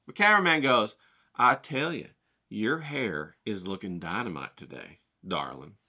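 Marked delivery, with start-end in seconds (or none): 1.4-5.8 lower, softer, slow charmer's croon; smooth talker. Retold or imitated. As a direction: sweet-talk her gently. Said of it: "my cameraman says to her".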